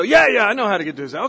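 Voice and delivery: lightly played codger, quick and loud; old codger. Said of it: "you find some old codger".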